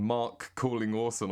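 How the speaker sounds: "Mark" with an open ah in place of Mork; botched American attempt. Shown as a demonstration. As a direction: slip "Mark" in for Mork, slowly. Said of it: "you end up saying".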